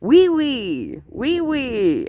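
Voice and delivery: grandson's mock French oui oui; imitating mock French. Grandma imitating her grandson's French echo. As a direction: echo a kid repeating French. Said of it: "I heard him say".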